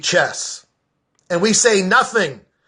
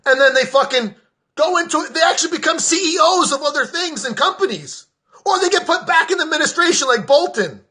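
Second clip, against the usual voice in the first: angry high rant; 7 semitones higher than usual. Real, not acted. Ranting peak, pitch stays high.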